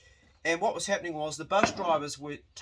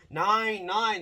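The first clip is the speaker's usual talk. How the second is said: German nein; 5 semitones higher, 5 dB louder than usual. Retold or imitated. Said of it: "the Germans on the bus are going".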